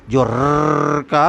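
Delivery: रर्र rolled slowly on the tongue tip; trilled r. Shown as a demonstration. Teacher showing the tongue vibrating on रर्र. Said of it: "उच्चारण हम करते हैं".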